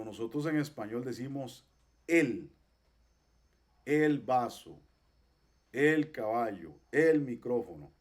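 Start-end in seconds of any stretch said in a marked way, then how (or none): 2.1-2.5 el set apart with a firm E; strong E. Shown as a demonstration. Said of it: "es una E fuerte". 3.8-7.9 "el" stressed loud before each noun; strong E. Shown as a demonstration. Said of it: "esa E es así fuerte".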